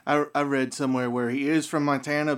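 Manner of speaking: ordinary talk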